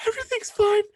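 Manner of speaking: pretending to be fine to his wife; mock brave. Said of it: "I was like".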